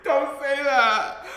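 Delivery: high, drawn-out outcry with laughter; laughing, scandalized shout; loud, laughing protest. Real, not acted. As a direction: cry out loud and long in mock shock. Outraged reaction to a comment.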